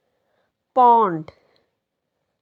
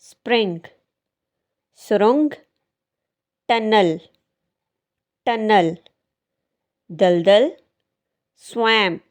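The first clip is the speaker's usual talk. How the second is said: ordinary delivery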